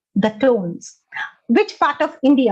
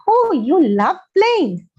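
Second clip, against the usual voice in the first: slow, higher-pitched, swooping delight; delighted echo; 5 semitones higher, slower than usual. Shown as a demonstration. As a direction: echo it back with drawn-out enthusiasm. Models enthusiastic echo to build rapport.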